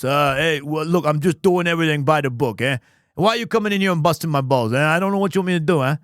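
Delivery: put-on fast, brash Bronx cop, New York accent; busting the listener's chops in thick Bronx. Streamer voicing Detective Gumshoe for chat, fast Bronx accent. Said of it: "a himbo Bronx".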